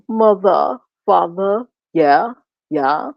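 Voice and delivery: exaggerated English accent, word by word; mock English accent. Mocking his fake accents, put-on English accent. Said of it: "like he from England".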